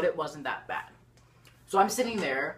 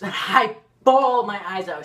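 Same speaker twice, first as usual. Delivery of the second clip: loud laugh, then slow words; 4 semitones higher, 10 dB louder than usual. Real, not acted. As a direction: laugh about how hard you cried. Laughing while retelling the crying.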